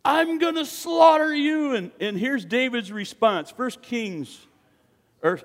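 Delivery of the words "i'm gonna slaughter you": high roaring taunt at the top of the lungs; giant shouting. Acted. Giant taunting David across the battlefield, roaring. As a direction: bellow down at the little shepherd. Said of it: "The giant shouting at the top of his lungs".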